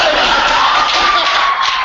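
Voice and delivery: very high, loud burst of laughter; high shrieking laugh. Real, not acted. Laughing at the memory of the friend turning pages. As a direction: let a real shriek of laughter out.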